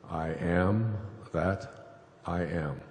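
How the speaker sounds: I am that I am, drawn out word by word; slow, solemn declaration. Acted. Speaking God's name to Moses, slow and solemn. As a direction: let each word of the divine name land slowly. God names himself, slowly.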